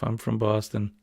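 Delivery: normal talking voice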